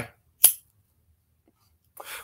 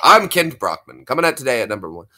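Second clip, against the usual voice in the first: brisk cartoon anchorman; Kent Brockman. Anchor opening the broadcast, newscaster voice. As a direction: rattle off the intro like a newscaster. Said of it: "The news guys from The Simpsons".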